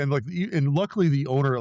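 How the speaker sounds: ordinary delivery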